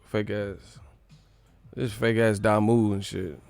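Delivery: ordinary talk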